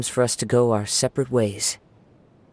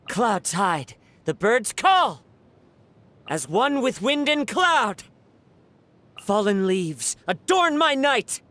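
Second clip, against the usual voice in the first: high, forceful combat calls; battle cries; 9 semitones higher than usual. Acted. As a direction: cut through the air with each call, much higher.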